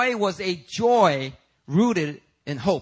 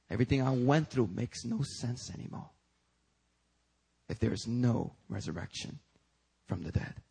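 hushed despair; 3 semitones lower, 5 dB quieter than usual. Acted. Voicing Paul's despair.

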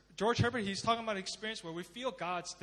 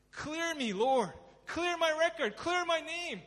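high, insistent cry to God; urgent plea; 8 semitones higher, 4 dB louder, slower than usual. Acted. David begs God to clear his record, urgent plea. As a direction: plead high, building louder with each demand.

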